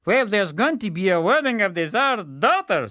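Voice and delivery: high, loud simpleton; the fool. Acted. Fool telling the weakling of the wedding. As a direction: announce the news eagerly. Fool's voice.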